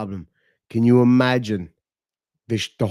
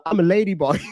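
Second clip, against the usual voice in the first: put-on half Thai half Cork accent; 5 semitones higher, faster than usual. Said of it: "this really cute half Thai, half Cork accent".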